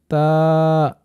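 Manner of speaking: তা held for one alif length; drawn-out letter. Shown as a demonstration. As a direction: stretch তা clearly. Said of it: "এক আলিফ টান দিয়ে বলতে হবে". (তা এক আলিফ টেনে)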